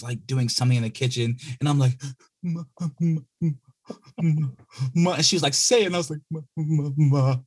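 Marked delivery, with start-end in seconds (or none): 2.4-7.4 put-on high, hesitant little-boy calling; nervous kid calling mom. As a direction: tug at mom's attention.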